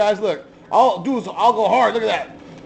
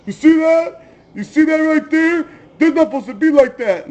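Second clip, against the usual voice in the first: high, whiny mock voice of a clueless guy; mock whiny higher voice, teasing; mocking another YouTuber; 9 semitones higher than usual. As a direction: ham up the know-it-all pointing at his car. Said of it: "He goes".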